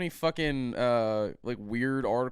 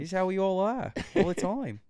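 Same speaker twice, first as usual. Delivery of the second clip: mock Australian accent; 6 semitones higher than usual. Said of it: "stop talking like a cartoon kangaroo".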